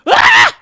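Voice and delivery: very high, loud furious shriek; angry scream. Acted. Wife screams in anger at her husband, a very high loud shriek. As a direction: shriek it out in fury. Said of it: "Marah istrinya".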